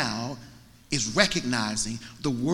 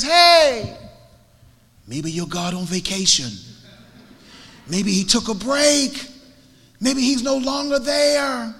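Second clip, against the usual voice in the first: high, loud mocking call; acted taunting; 10 semitones higher, 7 dB louder than usual. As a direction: needle them with drawn-out, raised-voice suggestions. Elijah taunts the rival prophets.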